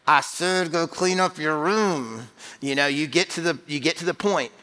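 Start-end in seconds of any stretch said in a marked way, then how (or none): 0.1-2.3 slow, drawn-out parental order; emphatic parent. Acted. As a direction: play a parent who is repeating an order emphatically. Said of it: "they get more emphatic".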